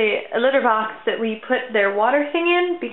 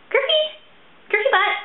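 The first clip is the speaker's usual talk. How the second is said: high call across the room to the cat; 13 semitones higher, 4 dB louder than usual. Real, not acted. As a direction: call the kitty loudly and high. Calling the cat.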